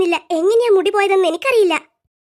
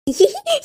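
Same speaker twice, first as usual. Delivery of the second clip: high-pitched teasing giggle; 5 semitones higher than usual. Acted. Laughing at the others for being fools, high giggle. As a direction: giggle at their foolishness.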